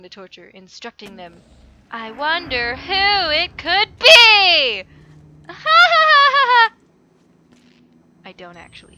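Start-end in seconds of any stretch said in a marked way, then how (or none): 1.9-4.8 slow, loud theatrical sarcasm; sarcastic villain-reveal tone; mock dramatic wonder. 4.9-6.7 high, loud hammed-up villain laugh; mock evil laugh; evil cackle. Evil laugh after sarcasm.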